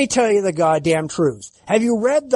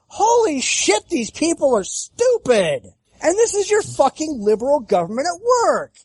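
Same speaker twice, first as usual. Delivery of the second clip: exasperated rant; 7 semitones higher than usual. Real, not acted. Ranting about the government.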